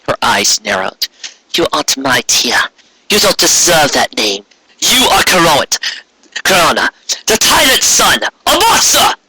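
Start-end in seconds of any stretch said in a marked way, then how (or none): 1.5-9.1 from level to much higher cry of a monster!; defiant sister, rising to fury; building anger. Acted. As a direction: build the insult to a furious peak. Luna insults Corona, rising.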